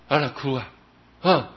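ordinary talk

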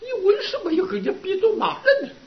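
high, fast, laughing self-rebuke; 你为什么要跟人家比着骂人呢 asked high and quick with a laugh; self-reproach, laughing. Retold or imitated. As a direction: scold your younger self through a laugh. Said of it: "想起那个惭愧".

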